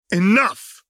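high, loud shout; snapped. Acted. Jinx silencing Echo, loud high shout. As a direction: cut him off hard with a yell. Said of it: "Jinx snapped".

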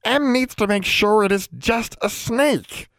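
slow, high elf insistence; grave and insistent in a high voice; very serious. A narrator's reading. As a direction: spell it out slowly, pitched high. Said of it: "The elf sounded very serious".

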